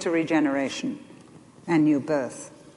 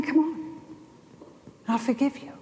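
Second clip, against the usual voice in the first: higher and softer, arms-wide welcome; gentle, inviting God; 5 semitones higher, 4 dB quieter than usual. Retold or imitated. God calling his children back, gentle and higher. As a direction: coax the child home. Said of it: "And he's saying".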